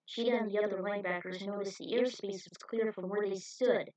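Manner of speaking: ordinary delivery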